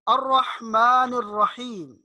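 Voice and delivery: slow recitation, pharyngeal ح in الرحمن الرحیم; correct deep ha. Shown as a demonstration. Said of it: "ہا کا جو تلفظ ہے". (الرحمن الرحیم میں مخرج سے حا)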